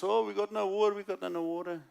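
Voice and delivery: hammed-up moan about the water; moaning Israelites. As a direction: whine it like a grumbling crowd. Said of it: "the Israelites were moaning".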